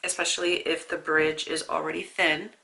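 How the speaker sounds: usual speaking voice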